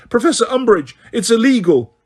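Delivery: a desperate student begging; frantic protest. A narrator's reading. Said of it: "shrieked Hermione".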